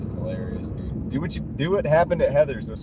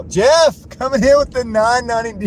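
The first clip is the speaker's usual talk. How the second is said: loud, much higher hype yell announcing a donor; excited shout; 10 semitones higher, 8 dB louder than usual. Real, not acted. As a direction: shout the donation out, thrilled to bits. Streamer hyping a big donation.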